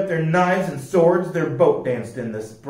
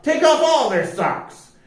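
higher shouted order; battle cry; 11 semitones higher than usual. A narrator's reading. Joe ordering his men mid-attack, shouted. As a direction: urge the attack on. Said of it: "Joe cried out".

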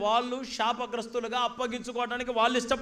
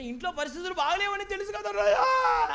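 mock crying; 9 semitones higher, 4 dB louder than usual. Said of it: "అని ఎడుతుంది మళ్ళీ".

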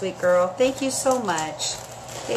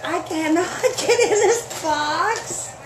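slow, breaking into laughter mid-line; laughing while struggling; 7 semitones higher, slower than usual. Real, not acted. Host laughing to viewers as the taped box won't open.

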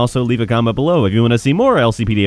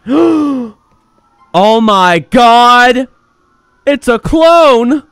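high, loud drawn-out shock; horrified discovery; 10 semitones higher, 9 dB louder, much slower than usual. Pretend. Cat officer discovering a clone of himself, hammed-up horror. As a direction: cry out in slow, hammed-up horror.

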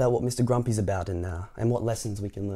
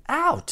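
very high, short ouch; pained yelp; 4 dB louder than usual. A narrator's reading. Mister Happy gets his foot stepped on, yelping. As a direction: yelp it, pitched way up.

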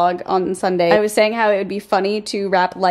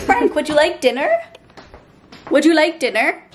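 high sing-song voice to the cat; pet talk; 8 semitones higher than usual. Real, not acted. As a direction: coo at the cat. Talking to the cat.

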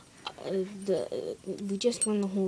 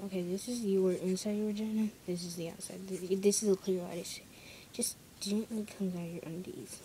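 ordinary delivery

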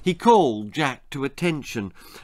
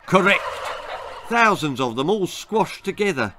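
raised-pitch military reply; overbearing sergeant turkey; drill sergeant; 5 semitones higher than usual. Acted. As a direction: confirm it like a parade-ground officer. Sergeant turkey confirms the answer.

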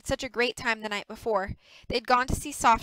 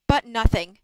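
loud, abrupt cut-in; interrupting; 11 dB louder than usual. A narrator's reading. Dwayne overriding Maddie, interrupting firmly. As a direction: cut across her firmly and loudly.

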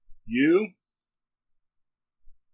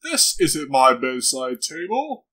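ordinary talk, nothing marked

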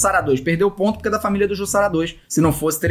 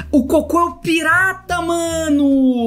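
high, thrilled exclamations; light up with excitement; excited delight; 8 semitones higher, slower than usual. Real, not acted. Excited discovering pirate pet. (empolgação aguda)

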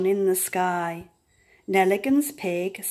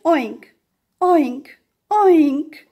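louder, higher grunting oinks; loud pig oink; 4 semitones higher, 6 dB louder, much slower than usual. A narrator's reading. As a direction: grunt like a pig getting excited.

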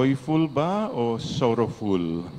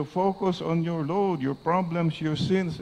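tempter voice; 7 semitones higher than usual. Retold or imitated. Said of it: "The evil one will always tell you".

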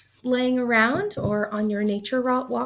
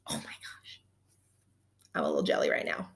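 breathless whisper of envy; whispered amazement at the rocks; awed whisper; 6 dB quieter, faster than usual. Real, not acted. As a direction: hush it like a secret marvel. In awe of heart rocks.

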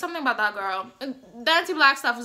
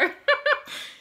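short high-pitched laugh. Real, not acted. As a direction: burst out in a high giggle. Laughs after her war remark.

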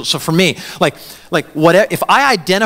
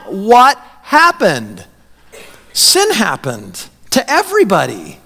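preaching; 4 semitones higher than usual. Real, not acted. Preacher stirring the congregation, preaching at full force. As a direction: press the point with rising heat. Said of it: "preaching at full force".

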